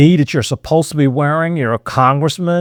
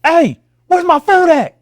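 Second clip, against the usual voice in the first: high, hollered complaint; loud demand. Shown as a demonstration. Customer making a scene over food, high yelled demand. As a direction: yell across the room for your order. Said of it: "where you just calmly said ... Versus".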